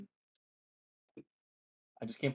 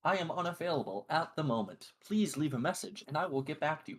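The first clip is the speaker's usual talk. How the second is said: automated voicemail voice; 6 semitones higher than usual. Retold or imitated. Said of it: "greeted him in an automated voice message tone".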